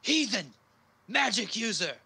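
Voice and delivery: high-pitched cry of a startled guard; shocked cry. Acted. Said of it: "as he cries out".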